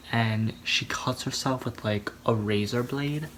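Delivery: ordinary talk, nothing marked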